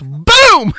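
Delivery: loud, high sound-effect shout; excited boom. Real, not acted. Host telling friends about the kick landing, shouting boom. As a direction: punch it out like an explosion. Two men kick each other.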